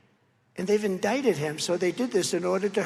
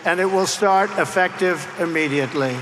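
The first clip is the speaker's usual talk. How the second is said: louder and slower, hammering the threat home; retold loud threat; 7 dB louder, slower than usual. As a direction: lay down an ultimatum, loudly. Retold threat to French president.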